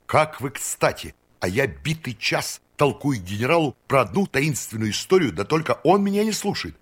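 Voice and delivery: put-on high, eager voice of an eccentric old count; excited eccentric count. A narrator's reading. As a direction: bubble over with eager excitement.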